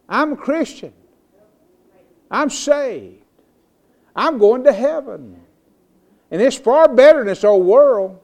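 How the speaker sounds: high, loud pulpit declaration; emphatic preaching. Real, not acted. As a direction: declare it boldly to the whole congregation.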